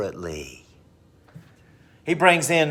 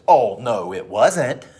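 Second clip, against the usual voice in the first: loud, swooping indignant denial; hammed-up teenage back talk; sassy attitude; 5 dB louder than usual. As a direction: act the mouthy teen denying everything. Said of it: "cops the attitude and goes".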